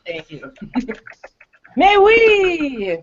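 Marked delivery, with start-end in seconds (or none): 1.8-3.0 high, loud mock-French exclamation. Player teasing the game master over the French accent, mock French. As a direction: belt it out in hammed-up French, far higher. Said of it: "attempt to do a French accent".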